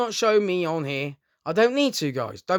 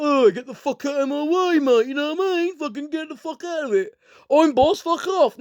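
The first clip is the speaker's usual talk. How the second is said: mock bully; 6 semitones higher than usual. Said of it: "I won't come up to you and be like".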